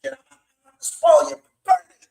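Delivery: building to a shrill high scream; desperate screaming. Retold or imitated. Terrified man screaming at the demons, building scream. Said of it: "he's screaming".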